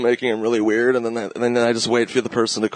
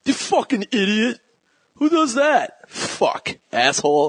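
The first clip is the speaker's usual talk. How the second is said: hammed-up yell, much higher; mock angry Jay; 9 semitones higher, slower than usual. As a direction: fake rage at a friend who's late. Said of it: "Jay goes".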